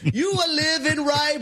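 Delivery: high, drawn-out pulpit proclamation; mock preaching. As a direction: bless them loftily like a preacher, for laughs. Mock sermon with bible.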